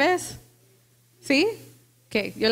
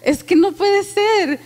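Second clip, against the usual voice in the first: exasperated; 6 semitones higher, 5 dB louder, slower than usual. Retold or imitated. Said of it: "Y yo decía".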